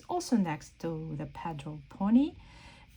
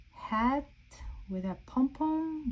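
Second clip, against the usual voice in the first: normal talking voice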